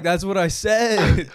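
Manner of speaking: smug bro. Retold or imitated. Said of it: "I was like".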